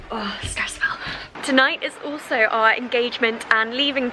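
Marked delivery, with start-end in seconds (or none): 0.1-0.8 whispered, weary sigh of stress. Real, not acted. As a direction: breathe it out, worn out. Moving day stress, sighing aside.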